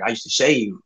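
usual speaking voice

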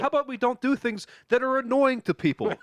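high-pitched, fed-up telling-off; exasperated scolding. Real, not acted. Host telling a noisy player to stop, exasperated.

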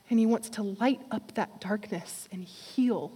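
ordinary talk, nothing marked

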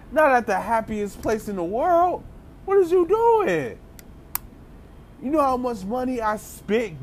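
high, pleading, exasperated dad; high-pitched put-upon father, half yelling; upset dad. Retold or imitated. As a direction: beg them to stop in front of your kid. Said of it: "He was like".

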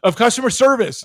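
angry shout of the word he finally remembers; real anger. Real, not acted. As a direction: blurt it out, fuming. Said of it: "See, I'm so angry".

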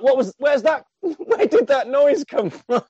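high startled question breaking into laughter; startled, laughing. Retold or imitated. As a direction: ask it in mock alarm, laughing through it. Punks startled by scream.

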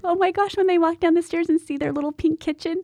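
put-on high, breathless child excitement; excited kids voice. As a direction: squeal it like a thrilled kid. Kids' excitement acted out.

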